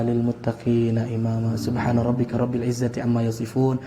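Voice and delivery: quick, low, monotone recited dua; low formal Arabic recitation; closing Arabic prayer. Real, not acted. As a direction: recite the prayer in a steady, rapid chant.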